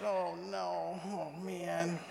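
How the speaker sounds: soft, drawn-out dread; dismayed groan. Retold or imitated. Husband hears wife accepted Jesus, soft dismayed aside. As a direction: sink into a hushed mock lament. Acting dread at wife's news.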